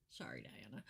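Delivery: quick whispered apology under the breath; whispered aside. Real, not acted. Whispering sorry to Diana mid-sentence, hushed.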